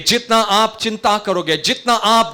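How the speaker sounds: fast, high, loud exhortation; full-force preaching. Real, not acted. Preacher warning worriers they hinder God, full-force preaching. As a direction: hammer out each rising warning rapidly.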